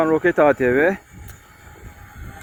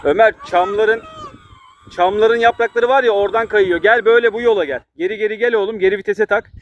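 higher, louder shouted instructions from afar; calling out; 7 semitones higher, 4 dB louder than usual. Real, not acted. Father calls to son on ATV.

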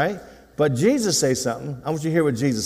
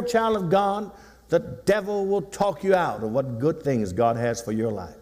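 ordinary talk

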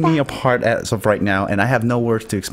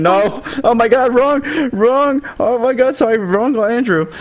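high-pitched, flustered outburst; flustered panic; 9 semitones higher than usual. Real, not acted. Streamer correcting the wrong Andrew shout-out, flustered.

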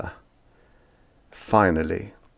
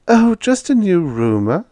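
high, louder light reply; smiling landlady; 11 semitones higher, 6 dB louder than usual. A narrator's reading. Landlady brushes off her roomer's question with a smile. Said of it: "smiled Missus Tibbets".

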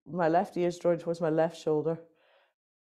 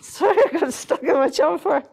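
laughing; 9 semitones higher, 7 dB louder than usual. Real, not acted. Laughing while stuck in jumper.

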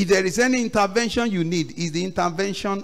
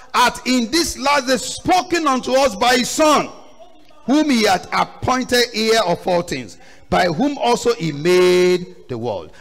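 raised, ringing Bible reading with drawn-out words; scripture declamation; 5 semitones higher, 4 dB louder than usual. Real, not acted. Preacher reads Hebrews to the church, scripture declamation. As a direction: proclaim the verse to the congregation.

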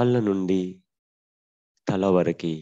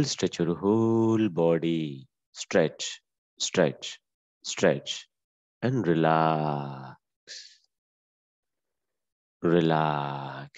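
stretching cue then release; much slower than usual. Acted. Yoga teacher calling stretch then relax, slow and easing. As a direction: coach the stretch, then let it all go.

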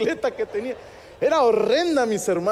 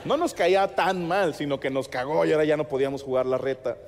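normal talking voice

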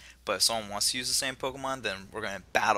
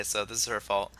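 ordinary talk, nothing marked

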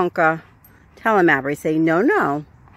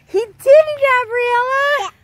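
excited praise; 12 semitones higher, 4 dB louder than usual. Real, not acted. Praising the dog after a trick.

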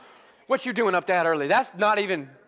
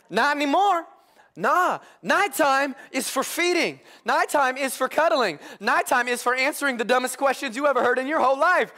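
higher, louder, building comic complaint; worn-out dad ranting to the church; exasperated; 7 semitones higher, 4 dB louder than usual. Real, not acted. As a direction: vent about the night feeds. Preacher riffing on night feeds.

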